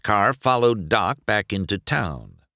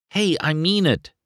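higher, earnest insistence; insisting; 10 semitones higher than usual. A narrator's reading. McQueen insisting to Doc, earnest and pushing. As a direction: press the point eagerly. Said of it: "McQueen insisted".

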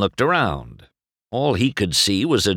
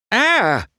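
short, high, loud yelp of fright; startled "Ah!"; startled cry; 5 dB louder than usual. A narrator's reading. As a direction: yelp as if caught off guard. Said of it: "McQueen cried, startled".

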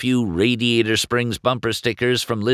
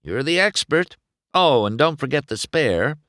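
lightly voiced race car; 8 semitones higher, 3 dB louder than usual. McQueen's voice.

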